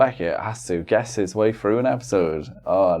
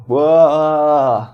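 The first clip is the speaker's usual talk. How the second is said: mock whoa; 5 semitones higher, 5 dB louder than usual. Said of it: "he's like".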